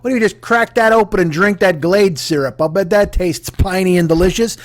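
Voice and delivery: usual speaking voice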